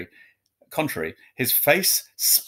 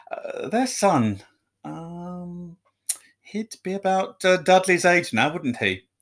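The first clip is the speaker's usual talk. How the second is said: forced casual; 5 semitones higher, slower than usual. A narrator's reading. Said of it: "as casually he could".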